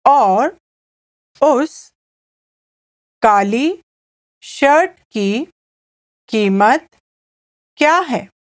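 word by word with long pauses; measured language-drill delivery, gaps for repeating; slow repeat-after-me. Shown as a demonstration. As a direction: say it slowly in small chunks for a learner. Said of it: "please repeat it with me".

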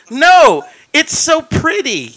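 imitating mother. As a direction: protest loudly, then gush slowly as his mother. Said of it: "And she goes".

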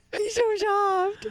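high, slow, loud gushing; gushing squeal. Real, not acted. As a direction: coo it like hugging a plush toy. Gushing over mascot softness.

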